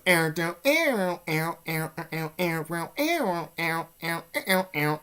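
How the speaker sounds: lightly voiced chant of air, air; imitating the voice. The voice in his head repeating, lightly chanted. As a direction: chant it like a hallucinated voice. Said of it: "It goes".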